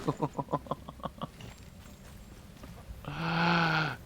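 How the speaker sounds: bright burst of laughter; laughing. Real, not acted. Laughs at his own quest experience.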